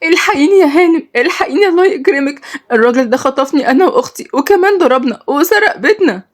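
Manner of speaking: high, tearful begging; crying plea. A narrator's reading. Kidnapped girl begging the lady through tears. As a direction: plead through tears for help. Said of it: "قالت بعياط".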